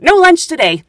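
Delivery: loud, high acted proclamation; mock-announce it high and punchy, falling at the end; decisive announcement. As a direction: call it out as a blunt verdict to the cabin. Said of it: "he finally said".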